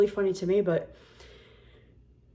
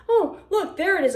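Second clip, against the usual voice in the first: mock cheerful; 9 semitones higher, 6 dB louder than usual. Finding the sneezed-on card.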